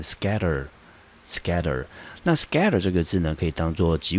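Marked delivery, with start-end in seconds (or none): 0.0-1.8 scatter，scatter with SCA stressed, slow; slow model of scatter，scatter, SCA-tter; stress on first syllable. Shown as a demonstration. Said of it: "重音节就落在第一个音节".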